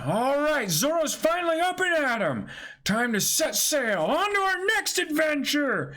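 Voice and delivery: high, fast and excited; high-pitched captain raring to set sail; eager captain. Acted. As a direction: rush everyone toward the adventure. S1 voicing the captain, setting sail.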